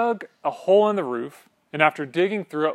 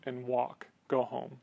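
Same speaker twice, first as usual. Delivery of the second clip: gentle quiet command; 4 semitones lower, 10 dB quieter than usual. Acted. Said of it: "He says to the paralyzed man".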